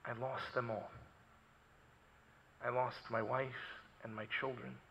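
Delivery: low, very soft quoted confession; hushed, understated retelling of loss; matter-of-fact grief. Retold or imitated. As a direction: state the loss simply, almost under the breath. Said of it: "said it matter of fact".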